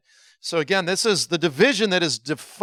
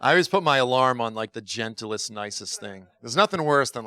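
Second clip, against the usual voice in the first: ordinary delivery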